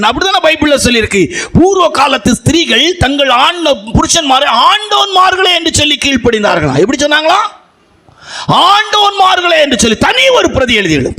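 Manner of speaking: high, fervent sermon climax; preaching at full force. Acted. Pastor to wives about obeying husbands, fervent high preaching. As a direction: proclaim it like a revival preacher.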